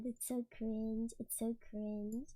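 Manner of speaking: low, hushed and slow; quiet cringing. Real, not acted. Embarrassed by own video, quiet cringing. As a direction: say it small and low, drawn out. Cringing to herself, dropping away.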